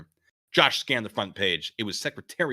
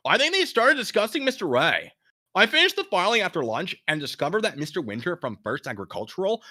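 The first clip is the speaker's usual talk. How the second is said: drifting California voice; 6 semitones higher, 5 dB louder than usual. Acted. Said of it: "Where was that voice going?".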